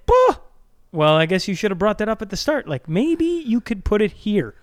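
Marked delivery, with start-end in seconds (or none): 0.0-0.4 a loud, high burst of "Bah!"; frustrated bah. Real, not acted. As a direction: blurt out a fed-up "Bah!". Frustrated outburst mid-argument.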